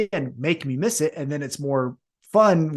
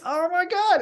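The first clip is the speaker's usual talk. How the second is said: much higher, mock stunned fan; mock amazement; 13 semitones higher than usual. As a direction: gasp as the crowd would. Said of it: "and then it's".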